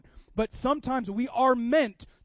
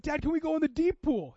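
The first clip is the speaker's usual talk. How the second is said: put-on higher, eager kid voice; son's eager question; 8 semitones higher, 4 dB louder than usual. Pastor retelling his son's plea at the pool, higher eager child voice. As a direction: play the eager little boy asking dad. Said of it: "He's like".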